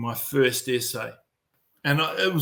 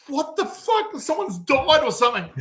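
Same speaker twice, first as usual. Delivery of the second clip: high, alarmed wife voice; wife's alarmed cry; 11 semitones higher than usual. Retold or imitated. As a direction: squeak it out like a panicked wife.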